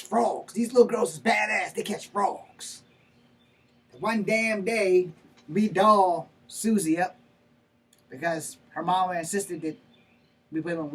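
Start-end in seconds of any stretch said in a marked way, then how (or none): 4.0-7.2 slow, loud, weighty delivery; emphatic storytelling. Acted. Father telling the court about dolling Susie up, slow and emphatic. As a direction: stretch each word out forcefully. Father builds to the fateful day.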